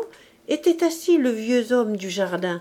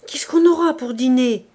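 a hint of a grumbling old woman, louder; nagging old woman; 3 semitones higher, 7 dB louder than usual. A narrator's reading. The old housekeeper scolds Mabeuf about unpaid rent, nagging. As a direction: press him, complaining and insistent.

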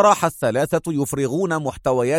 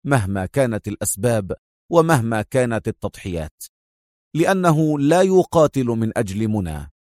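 plain reading voice

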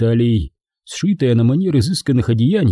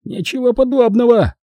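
much higher, falsely innocent protest; angelic innocence; 13 semitones higher than usual. Acted. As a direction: play the little angel while lying. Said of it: "с ангельским видом возразил грабитель".

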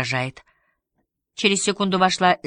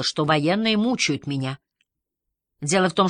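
ordinary delivery

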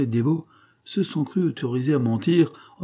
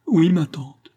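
higher, louder tearful yes; acted weepy admission; sobbing; 7 semitones higher, 6 dB louder, slower than usual. A narrator's reading. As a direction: wail the answer, mock-sobbing. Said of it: "les sanglots de Pitou redoublèrent".

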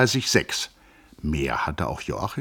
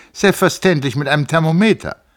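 higher, louder, mock-stern retort; 12 semitones higher, 11 dB louder than usual. A narrator's reading. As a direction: scold him with a sneer, much higher. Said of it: "nicht ohne Spott und Strenge". (spöttisch und streng, deutlich höher und lauter)

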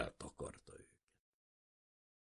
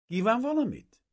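put-on high young woman's voice, falling at the end; girl's polite question; 8 dB louder than usual. A narrator's reading. Young woman asking a stranger at the fence, high and polite. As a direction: ask it as the young lady would.

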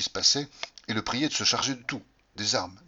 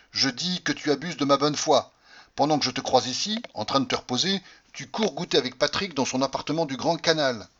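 higher, louder accusing tirade; jealous accusation; 5 semitones higher, 5 dB louder than usual. Acted. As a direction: confront the cheating wife. Mari jaloux accuse sa femme. (accusation furieuse du mari jaloux)

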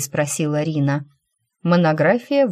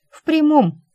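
loud retort; 12 semitones higher, 5 dB louder than usual. Acted. Sudden bright retort.

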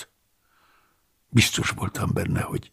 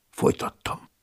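ordinary delivery

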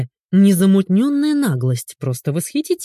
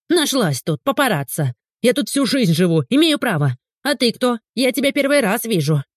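higher, agitated tirade; indignant squealing; 6 semitones higher than usual. A narrator's reading. Woman rants at Ulyana for filming her, indignant squealing. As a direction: protest in a high indignant voice. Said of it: "взвизгнула женщина".

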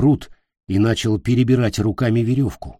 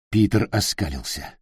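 ordinary delivery

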